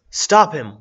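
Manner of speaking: loud alarmed yell. A narrator's reading. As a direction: shout the order to grab him. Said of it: "one of them yelled".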